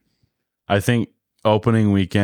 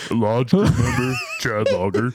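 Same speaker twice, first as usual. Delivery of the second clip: mock voice breaking into laughter; 4 semitones higher than usual. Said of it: "Did he change his voice?".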